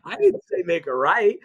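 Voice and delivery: an imagined nagging sat-nav snapping at a driver; mock angry GPS. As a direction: tell off the driver like a rude sat-nav, half joking. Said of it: "the G P S doesn't say".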